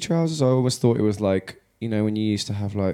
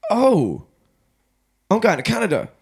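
higher, louder burst of news; excited announcement; 12 semitones higher, 5 dB louder than usual. Real, not acted. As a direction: blurt it out, thrilled. Announces trip to Canada.

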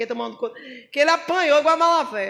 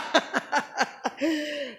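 risada solta; 6 dB quieter than usual. Real, not acted. Riso após piada.

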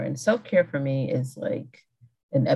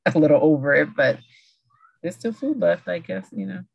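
ordinary talk

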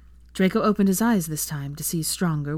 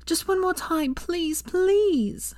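higher pleading; begging; 13 semitones higher, much slower than usual. A narrator's reading. Hermione begging Draco for one more try, higher. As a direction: plead with him, high. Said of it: "Hermione begged".

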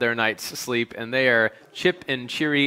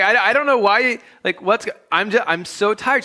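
defensive whine; 9 semitones higher, 6 dB louder than usual. Retold or imitated. Husband protests to wife.

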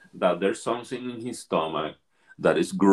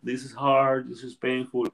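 mock pained screaming; 5 semitones higher, slower than usual. Facilitator imitates a participant in pain, mild mock scream. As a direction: imitate a man crying out, held back. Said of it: "the guy starts screaming".

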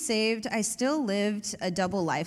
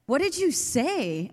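higher, slower, amazed question; stunned surprise; 6 semitones higher, slower than usual. Retold or imitated. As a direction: ask in disbelief, drawn out. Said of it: "I was like".

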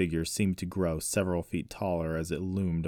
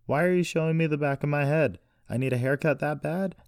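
higher, louder mock indignation; cheeky taunt; 9 semitones higher, 5 dB louder than usual. A narrator's reading. Captive woman joking to her unseen captor, cheeky taunt. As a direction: joke loudly at your captor.

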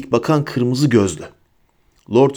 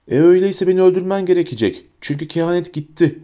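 high, understated defiant shout; 7 semitones higher than usual. A narrator's reading. Harry refuses Bellatrix's demand for the prophecy, shouting mildly. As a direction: raise the voice in defiance. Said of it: "diye bağırdı Harry".

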